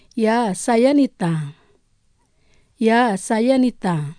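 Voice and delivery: slow, carefully spaced phrase; slow lesson read. Shown as a demonstration. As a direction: say each word slowly for learners to repeat. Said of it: "je vais prononcer lentement". (lentement, pour que les élèves répètent)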